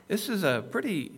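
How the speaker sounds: ordinary delivery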